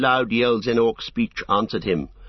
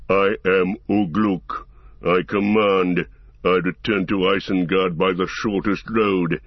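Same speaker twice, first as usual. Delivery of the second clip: growled warning; 3 semitones lower than usual. A narrator's reading. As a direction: growl it as a threat. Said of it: "said the growling voice".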